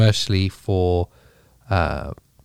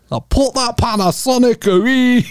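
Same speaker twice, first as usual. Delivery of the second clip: Mrs Doubtfire; 13 semitones higher than usual. Retold or imitated. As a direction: bark it like a stern Scottish nanny. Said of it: "that's the voice of Missus Doubtfire".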